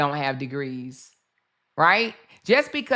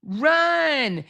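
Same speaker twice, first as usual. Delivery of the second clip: mock panicked shout, much higher than her usual voice; retold scream; 9 semitones higher, much slower than usual. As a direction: yell it like she's screaming for the girl to run. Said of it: "She says she was screaming for Brianna to run".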